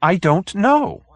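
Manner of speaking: high and slow, at a loss; helpless little man. Acted. Hoag answering the detective, high and helpless. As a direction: give up, helpless.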